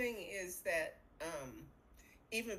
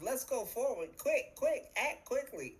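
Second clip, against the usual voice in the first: urgent go-go voice, rising higher; mock urgency; 5 semitones higher than usual. Astrologer voicing Mars as the planet of action, mock urgency. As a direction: urge them on, punching each quick. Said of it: "Mars is the whole planet of".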